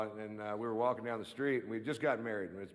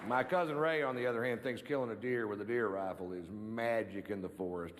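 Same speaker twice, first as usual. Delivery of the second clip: ordinary talk, nothing marked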